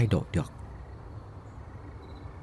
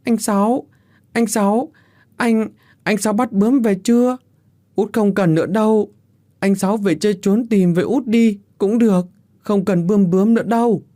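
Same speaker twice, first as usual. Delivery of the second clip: put-on high-pitched toddler voice, louder; babbling little child; 10 semitones higher, 5 dB louder than usual. A narrator's reading. Youngest sister calling her dead brother, childish babbling. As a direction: prattle like a small child who doesn't understand. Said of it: "cứ bập bẹ".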